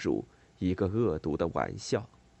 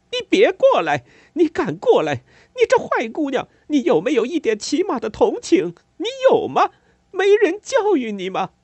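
high, loud, wild shouting; frantic furious yelling; 10 dB louder than usual. A narrator's reading. Mother screaming at the girl who mocked her son. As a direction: scream her away in a frenzy. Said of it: "尖利、狂乱，甚至颤抖".

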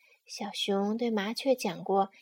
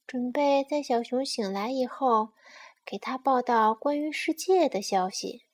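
usual speaking voice

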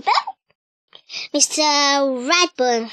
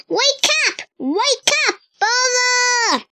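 much higher, carrying shout of a name; mother shouting; 10 semitones higher than usual. A narrator's reading. Mother yelling to wake Bella, high shout. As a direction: yell up the stairs for her daughter. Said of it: "she shouted".

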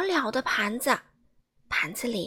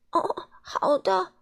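slow, higher groaning reply; stretching groan; 6 semitones higher, slower than usual. A narrator's reading. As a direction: groan it out, stuffed and sick. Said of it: "贪吃先生呻吟着说".

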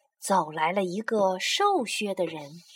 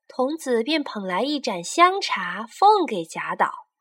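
ordinary delivery

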